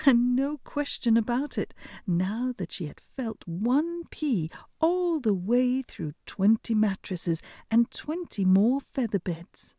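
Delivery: ordinary delivery, nothing marked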